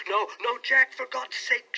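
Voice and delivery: high, slow, desperate gasping plea; a wife gasping out her plea. A narrator's reading. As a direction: beg him in high distress. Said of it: "she gasped in uncontrollable emotion".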